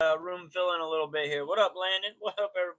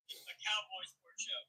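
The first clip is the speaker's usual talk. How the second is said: much softer repeat of the phrase; quiet echo; 4 semitones higher, 10 dB quieter than usual. Shown as a demonstration. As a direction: echo it back softly, like an afterthought. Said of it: "Echo that sentiment".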